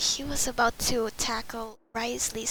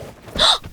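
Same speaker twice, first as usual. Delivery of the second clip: sharp high gasp of shock; sudden startled loud gasp; 10 dB louder than usual. Real, not acted. Character bursts in.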